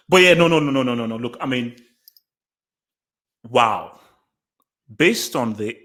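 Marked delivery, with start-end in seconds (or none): none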